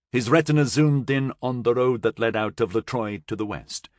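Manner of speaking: plain reading voice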